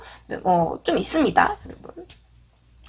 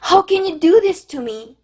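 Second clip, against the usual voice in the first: higher, acted wounded reproach; 감정이 상한 듯; 6 semitones higher, slower than usual. Shown as a demonstration. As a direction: protest as the one who was wronged. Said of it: "여러분 막 감정적으로 상했을 때". (원망하듯 높은 톤으로 따지기)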